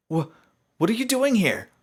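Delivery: stammering, high, loud alarm; startled young pony. Acted. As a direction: blurt it out in fright. Startled by the hooded figure.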